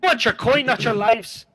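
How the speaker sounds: high, rough bandit calling out from the bushes. Acted. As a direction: play a cocky highway robber. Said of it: "You hear this voice is".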